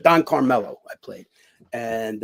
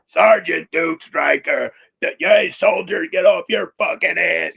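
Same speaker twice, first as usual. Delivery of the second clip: barking drill sergeant, louder and higher, slow and drawn out; drill sergeant shout; 5 semitones higher, 6 dB louder, slower than usual. Retold or imitated. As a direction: yell it at a lazy soldier like Sergeant Hulka from Stripes. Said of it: "I was like this".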